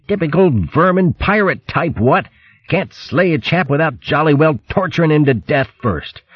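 high-pitched yelps and huffy complaint; yelp, then protest like an outraged toff; indignant hurt hare. Acted.

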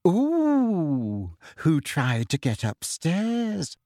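put-on high, girlish sing-out with a drawn-out ooh; high-pitched gleeful schoolgirl; giggly, happy. A narrator's reading. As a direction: tease gleefully, stretching the words slowly. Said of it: "they giggled happily".

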